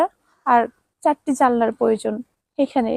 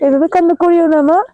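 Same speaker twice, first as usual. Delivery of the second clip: loud, higher plea to stop crying; loud pleading; 3 semitones higher, 6 dB louder than usual. Real, not acted. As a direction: plead loudly, tender but urgent. Begging children to stop crying.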